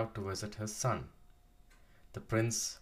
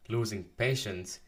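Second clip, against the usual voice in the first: usual speaking voice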